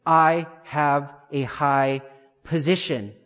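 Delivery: very slow, word-by-word declaration; measured, deliberate affirmation, drawn out; declared with conviction. Shown as a demonstration. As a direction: spell out each word slowly and firmly. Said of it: "speak out loud and say".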